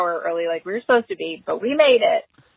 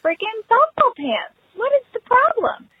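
exasperated inner thought; 13 semitones higher than usual. Retold or imitated. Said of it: "And I'm thinking".

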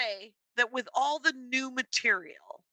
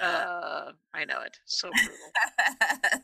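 creaky low vocal fry; 5 semitones lower, much slower than usual. Real, not acted. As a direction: let the voice crackle into fry. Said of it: "why am I talking like this?".

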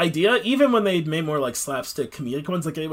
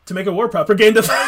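laughing; 5 semitones higher, 9 dB louder, faster than usual. Real, not acted. Joking interjection, laughing.

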